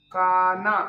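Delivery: first take of Kanha, projected to the class; emphatic demo. Shown as a demonstration. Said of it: "teacher demonstrates word twice".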